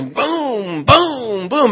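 punchy booms rising in pitch; mimicking wave impacts. Imitating the boat slamming waves, punchy booms. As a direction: thump out each wave hit.

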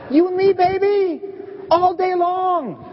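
high, slow swaggering croon; cocky Peter. Retold or imitated. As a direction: oversell your loyalty, baby-style. Peter boasting loyalty to Jesus.

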